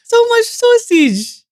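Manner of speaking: very high, slow, hammed-up Dutch accent; mock Dutch accent, high and very slow. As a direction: stretch it out like a cartoon Dutchman. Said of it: "You nailed it".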